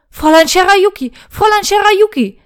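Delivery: high, loud frantic calling; panicked call. Acted. Knight bursts into her room, panicked loud calling. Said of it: "rief der Ritter panisch".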